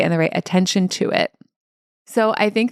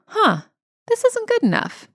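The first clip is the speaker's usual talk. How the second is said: much higher, curious discovery; neutral noticing. Shown as a demonstration. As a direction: notice it lightly, with a curious "huh". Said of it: "the second one in the different tone of voice".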